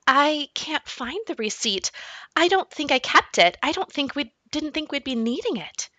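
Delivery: a mother admitting she lost the receipt; nervous mom. Acted.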